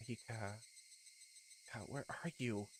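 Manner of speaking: soft, far-off calling; faint distant voice. Acted. Hanyo calling through the crystal, faint and soft. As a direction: sound like a voice fading away.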